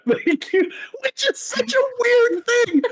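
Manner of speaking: high squeaky overwhelmed voice; overwhelmed by cuteness. Real, not acted. Cute aggression, voice squeaks high.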